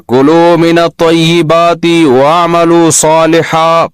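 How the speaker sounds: slow, drawn-out Arabic recitation of the verse; Quranic recitation. Acted. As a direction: intone the verse slowly like Quran reading.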